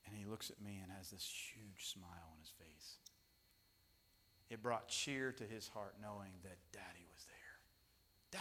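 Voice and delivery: soft whisper, tender. Real, not acted. Pastor recalling his son's smile, soft whisper. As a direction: hush it like a secret.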